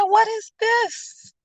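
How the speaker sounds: high, slow mock disbelief; incredulous. Customer shown a wipe of cream cheese, incredulous. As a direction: question it slowly in a high, put-on voice.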